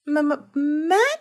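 slow stuttered م-، م-، من؟ on the first sound; stammering. A narrator's reading. Shy boy stammers back to grandma, unsure. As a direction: trip over the م twice before من.

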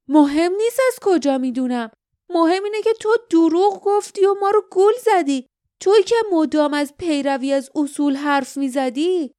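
high, loud, heated accusation; angry shout. A narrator's reading. Lif exposing the guardian's lie, angry shout. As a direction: shout him down, outraged. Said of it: "لیف فریاد زد".

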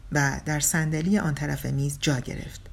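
usual speaking voice

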